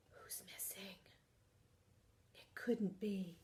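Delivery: very soft whisper after a gasp; whispered worry for the missing grandma; suspenseful whisper. Acted. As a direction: build suspense in a hush.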